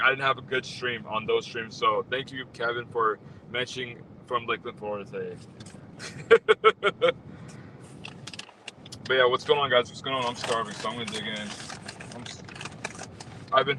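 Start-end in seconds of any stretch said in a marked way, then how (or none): 5.6-8.4 unvoiced high giggle; airy breathy laugh. Real, not acted. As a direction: laugh under your breath. Laughing about Kevin's greeting.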